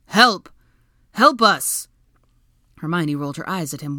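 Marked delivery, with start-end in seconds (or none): 0.0-1.9 very loud, raised-pitch call; shout. A narrator's reading. Draco joining the calls for rescue, loud shout. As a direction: bellow upward for help. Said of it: "their combined shouts carried".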